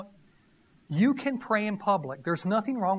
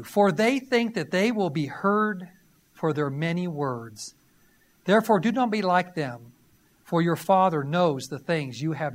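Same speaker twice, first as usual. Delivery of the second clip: firm, louder reading of scripture; 5 semitones lower, 3 dB louder than usual. Acted. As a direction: proclaim the verses to the congregation. Reads scripture again.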